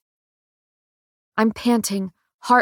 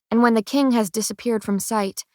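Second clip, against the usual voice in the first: plain reading voice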